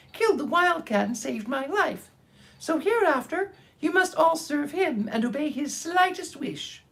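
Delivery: high, tiny royal squeak; a little mouse proudly declaring her rank; proud mouse queen. Acted. Mouse queen voiced in story.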